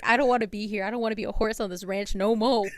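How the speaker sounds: loud, whiny protest from a horse sick of the ranch; fed-up horse. Pretend. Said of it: "He's like".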